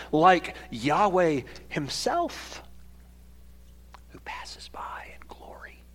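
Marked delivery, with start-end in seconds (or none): 4.1-5.8 awed whisper. Acted. Hushed climax of the sermon.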